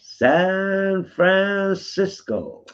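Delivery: high, very slow swooning drawl; Adam's drawn-out voice. Retold or imitated. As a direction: stretch it out high and dreamy. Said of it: "The voice I do is, is who you are".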